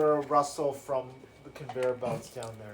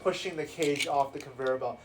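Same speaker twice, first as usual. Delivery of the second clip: ordinary talk, nothing marked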